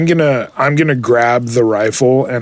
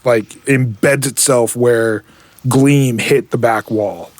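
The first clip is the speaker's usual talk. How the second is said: ordinary talk, nothing marked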